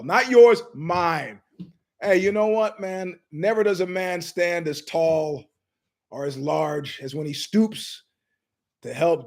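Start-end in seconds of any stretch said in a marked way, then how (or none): none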